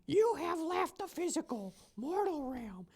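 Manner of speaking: trying a high floaty spirit voice, then dropping it; new character voice. Acted. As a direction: pitch it way up and draw it out like a spirit guide. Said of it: "I don't like that voice".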